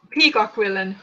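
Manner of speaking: ordinary talk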